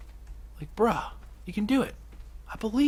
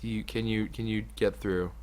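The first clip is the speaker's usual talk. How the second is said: ordinary talk, nothing marked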